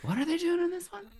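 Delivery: very high-pitched puzzled query. Retold or imitated. Host clueless about the script, mock puzzled high voice. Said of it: "Even [name] was like".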